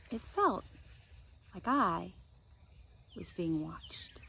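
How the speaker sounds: soft, slow, spooky close; eerie hush. A narrator's reading. Narrator closes the chapter with a slow, soft hush. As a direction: let it fade into a creepy hush.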